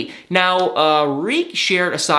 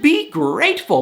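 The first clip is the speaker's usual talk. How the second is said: usual speaking voice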